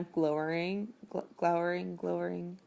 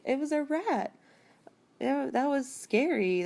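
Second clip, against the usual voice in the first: mock frightened; 7 semitones higher than usual. [name] freaks out at rat.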